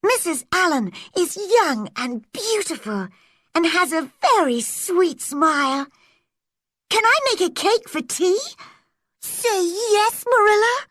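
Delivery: high, eager and fast; girl begging Marilla for a cake; excited. A narrator's reading. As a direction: rush the plea in excitement. Anne eagerly begs Marilla.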